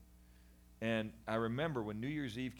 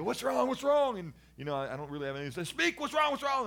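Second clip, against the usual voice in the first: high, loud panicked "what's wrong"; alarmed shouting; 13 semitones higher, 10 dB louder than usual. Retold or imitated. Parents bursting in on sobbing son, loud alarmed shout. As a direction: shout in alarm at the crying boy. Adults shouting at crying boy.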